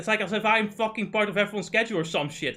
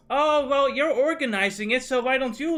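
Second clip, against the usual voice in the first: higher, louder sing-song mimic of excuses; mocking mimicry; 6 semitones higher, 4 dB louder than usual. Mocking friends who dodge scheduling, higher sing-song. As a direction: parrot their lazy excuse back mockingly. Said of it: "constantly I just hear".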